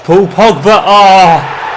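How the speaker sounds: loud, rising shout of the scorer's name; excited goal call. Acted.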